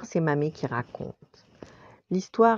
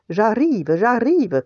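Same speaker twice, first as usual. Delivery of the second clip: higher, louder storybook call; 6 semitones higher, 6 dB louder, slower than usual. A narrator's reading. Tony answers the scratch at the back door, calling out. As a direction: call out as if from inside the kitchen. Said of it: "cria Tony".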